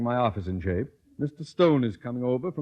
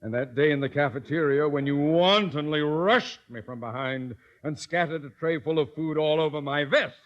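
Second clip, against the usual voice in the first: normal talking voice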